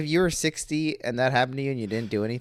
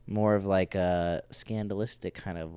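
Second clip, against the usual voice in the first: ordinary delivery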